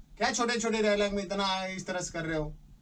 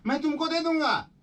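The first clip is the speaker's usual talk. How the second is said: much higher, punchy filmy line with दे stressed; filmy dialogue delivery; 12 semitones higher than usual. Shown as a demonstration. Dubbing director demonstrating dialogue for actor, filmy punch. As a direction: deliver it like a film hero with feeling. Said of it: "acting करके feeling के साथ dialogue".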